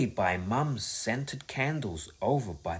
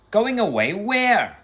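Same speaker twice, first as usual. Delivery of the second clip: dismayed; 9 semitones higher, 6 dB louder than usual. A narrator's reading. Bertie dismayed by trip.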